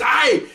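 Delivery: imitated "aye!" from a man just hit; sudden high yelp; high yelp of pain. Struck man cries out.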